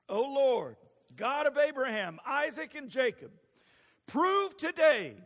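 loud, high plea to heaven; preacher acting a fervent prayer; impassioned prayer. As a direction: cry out the prophet's prayer, building to a drawn-out peak. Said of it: "walked up to the altar and prayed".

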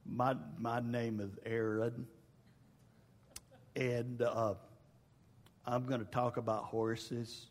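terrified first speech. Retold or imitated. Preacher retelling his first 4-H speech, in a small frozen mumble. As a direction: mumble it like a scared kid on stage. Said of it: "And I was".

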